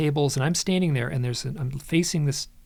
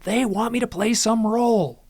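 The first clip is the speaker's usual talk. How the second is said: high, raised-voice mock scream; retold scream; 9 semitones higher, 5 dB louder than usual. Narrator retelling his answer to therapist, restrained scream. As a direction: cry it out, higher and louder, short of full screaming. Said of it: "And I scream".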